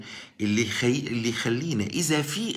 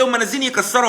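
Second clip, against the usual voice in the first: higher, louder mock insistence; imitating the objector; 12 semitones higher, 6 dB louder, faster than usual. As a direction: throw the objection back as someone else's claim. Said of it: "هتقول لي". (تقليد المعترض بصوت أعلى وأقوى)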